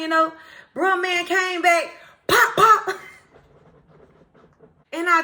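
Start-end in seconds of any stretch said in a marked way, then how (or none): 2.3-3.0 punch sounds. Retold or imitated. Retelling punches in a fight.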